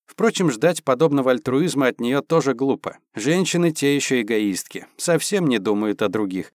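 ordinary delivery, nothing marked

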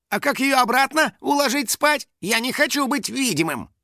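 high, urgent complaint; distressed pleading. Acted. Finik begging to be invisible, upset. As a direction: protest in a high voice.

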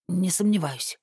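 plain reading voice

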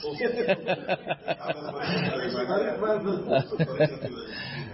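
soft real laugh; real laughter. Real, not acted. Class laughing at the sheikh's joke, softly.

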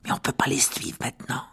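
hushed whisper. A narrator's reading. Ron whispering to Harry near eavesdroppers, hushed. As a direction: keep it from the others. Whispering so others won't hear.

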